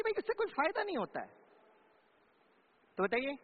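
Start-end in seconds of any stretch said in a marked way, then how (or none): 0.0-1.3 put-on higher, fast little-girl voice; lightly voiced sulky child; imitating a girl. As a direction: toss it off like a cheeky kid.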